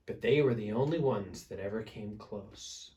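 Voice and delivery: plain reading voice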